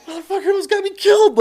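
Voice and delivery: high, fast furious recruits; angry recruits. Retold or imitated. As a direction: spit it out in outrage. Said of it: "and they're like".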